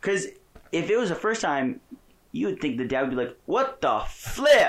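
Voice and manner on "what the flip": slow, loud, high-pitched disbelief; shocked outburst. Retold or imitated. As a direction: act out an appalled dad, drawn out. Imagining a shocked dad's reaction.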